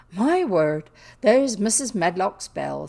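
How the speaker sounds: loud, sudden exclamation; startled exclamation. Acted. Maid hears the housekeeper's bell, loud startled exclamation. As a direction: exclaim loudly as if suddenly summoned.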